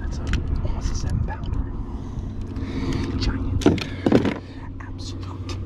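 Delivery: hushed, breathy whisper in awe. Real, not acted. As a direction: whisper over the big fish. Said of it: "I'm still whispering".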